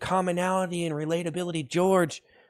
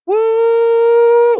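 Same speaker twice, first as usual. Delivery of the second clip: very high, loud woo; explosive hype whoop; loud whooping cheer; 11 dB louder than usual. Real, not acted. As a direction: burst into a piercing whoop. Cheering the stream.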